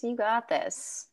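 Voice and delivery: soft, slow reassurance; reassuring. Real, not acted. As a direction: say it gently and slowly.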